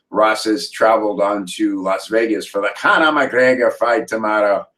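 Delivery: ordinary talk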